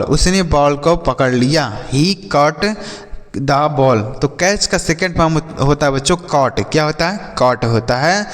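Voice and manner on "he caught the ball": ordinary talk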